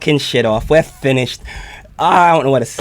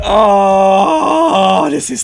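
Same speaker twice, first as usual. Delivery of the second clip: high, loud overwhelmed cry; reacting to the song, high and loud; hyped shout; 10 semitones higher, 7 dB louder, slower than usual. Real, not acted. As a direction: yell it out in delight. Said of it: "You're fucking up my day".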